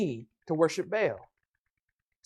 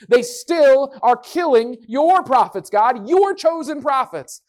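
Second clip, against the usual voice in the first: pleading Elijah; 6 semitones higher, 7 dB louder, slower than usual. Acted.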